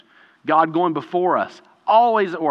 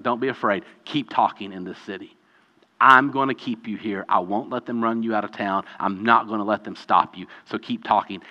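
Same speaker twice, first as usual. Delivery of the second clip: Jesus reassuring Paul; 7 semitones lower, 4 dB quieter than usual. Retold or imitated. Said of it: "Jesus shows up and be like".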